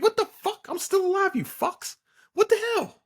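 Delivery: very high, indignant cartoon shriek; squeaky outraged Kon. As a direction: play the tiny plush toy furious at being counted out. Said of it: "he pretty much was talking his shit".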